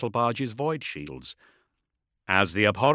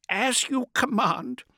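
high, slow astropath voice; 13 semitones higher, slower than usual. A narrator's reading. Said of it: "Isericor replied".

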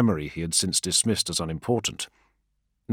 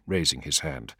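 ordinary delivery

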